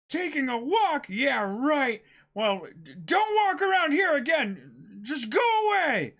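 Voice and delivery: scornful Luffy. Acted. Luffy mocks the admiral and orders him away. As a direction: brush off his excuse.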